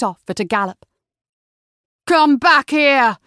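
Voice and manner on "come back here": loud, slow, higher furious shout; the robber shouting after the escaping carriage. A narrator's reading. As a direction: bellow after the fleeing carriage. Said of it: "The man's shouts were lost".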